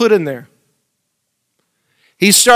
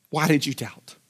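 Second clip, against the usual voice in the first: "Why did you doubt?" said much softer and lower; 3 semitones lower, 10 dB quieter than usual. Shown as a demonstration. Jesus gently repeating his question to Peter, much softer. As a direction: let the question fall to a near hush. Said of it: "repeated softer".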